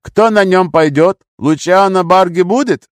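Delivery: high, slow, loud questioning; harsh demand. A narrator's reading. Gunman asking captive who waits ashore, loud demand. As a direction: demand it forcefully.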